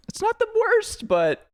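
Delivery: mock high-pitched verdict from a British friend; imitating British friend. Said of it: "he's like".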